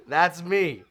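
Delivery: loud, high, through real laughter; self-mocking, words riding on a laugh; laughing admission. Real, not acted. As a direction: own up while still cracking up. Laughing at himself.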